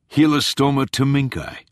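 pleased, showy delivery; proud. A narrator's reading. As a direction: present it like a prize. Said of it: "Tolland said proudly".

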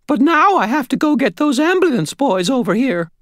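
high-pitched landlady voice. A narrator's reading. Landlady off to fetch ambulance crew, high-pitched. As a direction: announce it as a busy, relieved landlady.

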